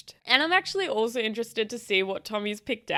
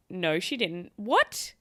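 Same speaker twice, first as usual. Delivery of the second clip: ordinary talk, nothing marked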